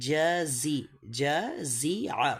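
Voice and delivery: জাজি, জাজিয়া very slowly with a clear z; drawn-out teaching model of জাজিয়া; careful syllable breakdown. Shown as a demonstration. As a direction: stretch each syllable of জাজিয়া, ja-zi-a. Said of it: "তো এটা কী বলবো বলেন".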